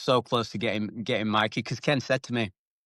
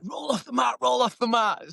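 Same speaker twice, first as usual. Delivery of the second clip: high, urgent mock shout; imitated coach yell; 12 semitones higher than usual. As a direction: call out orders like a coach from the sidelines. Retold coach yelling at fighter.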